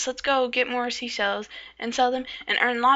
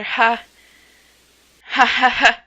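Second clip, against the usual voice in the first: loud, stiff ha ha ha; louder forced laugh; 9 dB louder, slower than usual. Acted. Told to laugh louder, loud forced ha ha ha. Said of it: "You should laugh louder".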